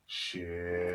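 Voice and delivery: breathy muttered swear under the breath; dazed muttered curse. Retold or imitated. Patient telling doctor of accident, muttered. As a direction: let it slip out half-voiced, stunned.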